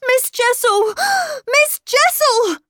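very high, loud angry cry; sudden angry shout. A narrator's reading. Miles shouting the name at the governess, suddenly angry. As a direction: shout the name, gasping between cries. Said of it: "he shouted, suddenly angry".

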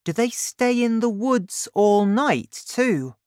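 put-on eager boy asking his dad; young Danny. Danny asks, voiced high.

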